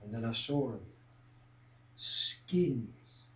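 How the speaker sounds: very low, soft, with pauses; hushed dread of what was seen; ominous hush. Acted. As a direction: drop to a murmur at the sighting. Ominous sighting in story.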